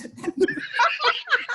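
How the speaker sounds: high burst of laughter; big laugh. Real, not acted. Cracking up at own story.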